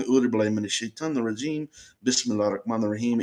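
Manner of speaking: rattled off fast under the breath, by habit; quick protective prayer. Retold or imitated. Man recalls his doorway prayer, recited fast and low. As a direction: rush through the familiar prayer at speed. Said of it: "I'm in the habit of anywhere I go".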